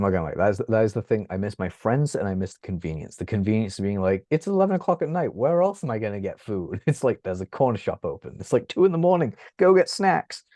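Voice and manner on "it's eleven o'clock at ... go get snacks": mock excited. Acting out late-night London self.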